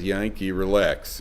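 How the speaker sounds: soft, slow, unbothered murmur; a gentle hush to yourself; calm soothing. Retold or imitated. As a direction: keep it low and easy, like nothing's wrong. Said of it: "he says".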